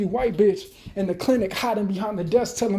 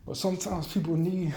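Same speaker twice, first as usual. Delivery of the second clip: ordinary talk, nothing marked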